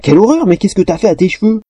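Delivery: high, horrified exclamation; appalled. A narrator's reading. Girl appalled by her friend's tangled hair, horrified. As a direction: recoil in dismay.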